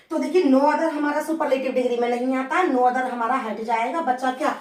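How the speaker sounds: ordinary delivery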